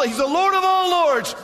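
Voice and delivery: high, loud preaching cry; preaching shout. Acted. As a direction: shout it to the rafters.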